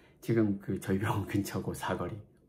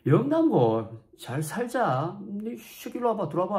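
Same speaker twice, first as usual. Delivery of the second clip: excited calling; 10 semitones higher, 4 dB louder, slower than usual. Retold or imitated. Vice principal excitedly calling.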